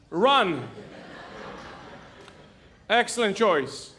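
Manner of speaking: normal talking voice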